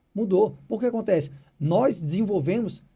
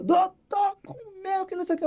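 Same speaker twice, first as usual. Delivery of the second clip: much higher, crying owner's voice; tearful relief; 11 semitones higher than usual. Retold or imitated. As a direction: imitate her sobbing with relief. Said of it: "quando comeu, ela me ligou".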